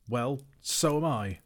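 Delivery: high, loud, slow retort; crying out. A narrator's reading. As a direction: call back in exasperation. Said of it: "cried Bilbo".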